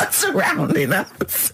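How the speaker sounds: high, slow words through giggles; helpless laughter. Real, not acted. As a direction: wheeze it out while cracking up. Cracks up at cows surrounding them.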